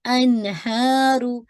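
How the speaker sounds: अनहारू drawn out; heavy ra in अनहारू; slow stretch. Shown as a demonstration. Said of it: "हल रोकेगा".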